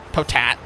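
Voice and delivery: Potat said "po-TAT", stopping before the last syllable; clipped potato. Shown as a demonstration. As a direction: Potat cut off after "TAT". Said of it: "I just call it a potat".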